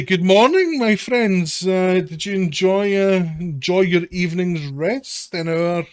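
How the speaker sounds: loud, high, cheery gang boss; falsely friendly toll collector, big and bright; bright greeting. Acted. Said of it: "sort of greets you brightly".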